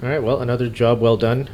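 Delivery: ordinary talk, nothing marked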